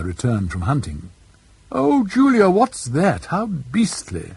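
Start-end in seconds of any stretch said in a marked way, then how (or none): 1.7-4.3 high, startled cry of distaste; a girl recoiling at something ugly; shocked then slowing in disgust; surprised disgust. A narrator's reading. Cordelia sees the tortoise.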